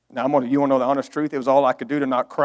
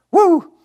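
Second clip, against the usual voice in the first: high loud preacher's whoop; joyful whoop; 14 semitones higher, 8 dB louder than usual. Real, not acted. Preacher whooping to his congregation, joyful. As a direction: let out a happy woo.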